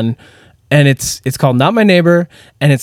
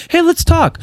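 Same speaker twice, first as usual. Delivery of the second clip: high playful voice; 12 semitones higher than usual. Retold or imitated. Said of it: "we go".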